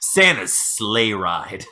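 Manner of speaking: very slow, low title reveal; mock trailer announcer. Host revealing his movie title, trailer announcer. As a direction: drop low and stretch out the title.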